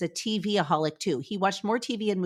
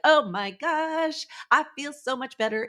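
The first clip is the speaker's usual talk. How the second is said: higher and slow, imitating sudden relief; a bright put-on gush of relief; mock relieved delight; 6 semitones higher than usual. Mock relief after a workout.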